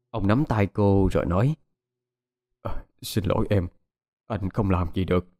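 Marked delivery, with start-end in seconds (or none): 2.6-5.3 subdued, resigned and a touch slack; helpless. A narrator's reading. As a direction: apologise as one powerless to act. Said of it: "nói với cái giọng bất lực".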